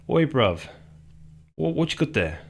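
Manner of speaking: put-on a light go at a chavvy London accent, rising high at the end; half-hearted chavvy London accent, admitting he can't really do it. Streamer showing viewers how chavs in his area talk, in a light chavvy London accent. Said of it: "pikey sort of accent".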